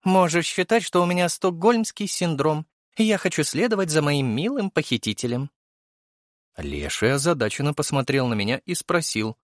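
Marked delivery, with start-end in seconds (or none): 0.0-5.5 high, teasing female voice; high-pitched, tongue-in-cheek reply; ironic young woman character. A narrator's reading. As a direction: play the captive with light irony. Venera keeps up ironic teasing.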